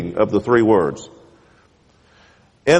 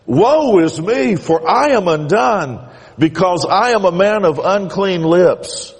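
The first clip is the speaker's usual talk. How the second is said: lamenting prophet; 7 semitones higher than usual. Acted. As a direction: cry out as a man undone. Isaiah laments before God.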